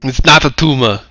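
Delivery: jokey put-on Terminator accent; hammed-up Terminator accent. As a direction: quote it in a movie Terminator's accent. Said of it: "accidentally give her that accent now".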